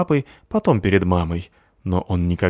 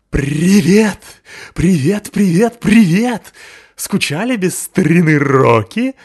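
much higher and louder, bouncing greeting; overexcited Rocky; 10 semitones higher, 8 dB louder, slower than usual. A narrator's reading. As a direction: burst in like a thrilled big dog. Loud dog bursts into yard.

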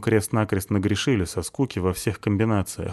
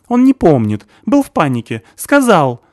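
high, loud frightened stammering; cornered man stammering; panicky stammer; 9 semitones higher, 10 dB louder than usual. A narrator's reading. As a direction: stumble over the words in fear.

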